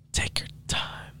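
slow, barely voiced whisper. Older wife warning a young single man, whispered. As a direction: whisper it slowly, like a secret warning. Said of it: "why are you whispering".

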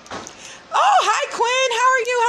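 bright high-pitched hello; high sing-song greeting. Real, not acted. Greeting someone off camera.

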